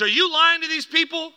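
high, loud accusing challenge; yelling. Retold or imitated. Dad confronts the pastor mid-service, yelling. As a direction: shout it up at the man on stage. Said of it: "starts yelling at the pastor".

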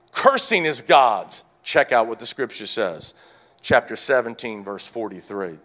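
ordinary delivery

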